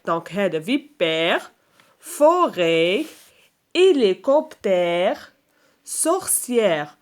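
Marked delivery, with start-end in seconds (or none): 2.0-7.0 slow, segmented word list; syllable-by-syllable. Shown as a demonstration. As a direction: draw out every syllable for listeners. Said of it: "le son qui se répète".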